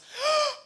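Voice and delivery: sharp high horrified gasp; shocked gasp. Retold or imitated. Pale buddy reacts to the joke with a horrified gasp. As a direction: gasp in pure horror.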